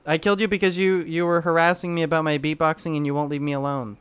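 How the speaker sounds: normal talking voice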